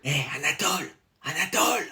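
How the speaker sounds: very soft whisper to a neighbour. Real, not acted. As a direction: whisper so the teacher won't hear. Flüstert während des Tests zum Nachbarn.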